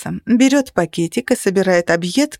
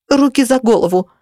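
higher-pitched sharp command; hands-up command; blurted order; 4 semitones higher than usual. A narrator's reading. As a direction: blurt it out like a movie robber. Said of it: "выпалила я".

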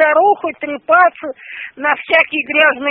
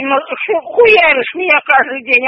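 ordinary talk, nothing marked

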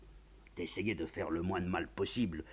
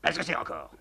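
annoyed; 8 semitones higher, 12 dB louder than usual. Acted. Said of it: "demande agacé".

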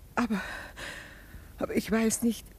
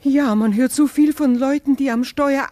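louder, higher worried plea; alarmed mother's cry; anxious outburst; 6 semitones higher, 9 dB louder than usual. Acted. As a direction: burst out with your fears. Mother pleads son drive carefully.

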